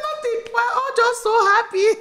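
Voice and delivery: high, bubbly, happy words; giddy reply. Retold or imitated. Answering her husband's question, a high giddy reply. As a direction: gush it out. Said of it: "I said".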